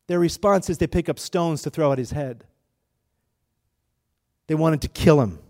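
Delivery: ordinary talk, nothing marked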